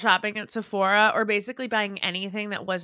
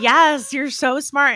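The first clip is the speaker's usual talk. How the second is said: gushing salesclerk; 5 semitones higher, 6 dB louder, much slower than usual. Retold or imitated. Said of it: "the Mac makeup artist is like".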